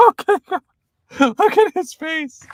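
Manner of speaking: high, loud laughing exclamation; helpless laugh spilling into the words; cracking up. Real, not acted. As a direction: squeal it through real laughter. Cracking up at the played clip.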